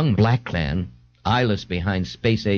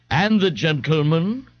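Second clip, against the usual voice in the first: slow, higher, affected bartender voice; rich, assumed voice; 8 semitones higher, slower than usual. A narrator's reading. A bartender asks after the gentleman in a rich, assumed voice. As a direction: put on a rich voice for the customer. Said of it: "asked in a rich, assumed voice".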